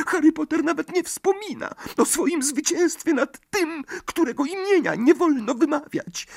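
very high, awed elf voice; a tiny servant in adoration; admiring house-elf. A narrator's reading. Dobby in awe. (zachwycony skrzat)

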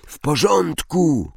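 high, drawn-out call upward; called out without real volume. A narrator's reading. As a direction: shout up to friends, stretched and high. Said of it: "krzyknął".

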